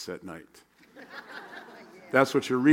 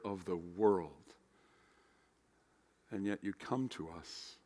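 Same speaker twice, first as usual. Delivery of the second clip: prayer; 3 semitones lower, 9 dB quieter than usual. Acted. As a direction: pray quietly and earnestly. Leads opening prayer.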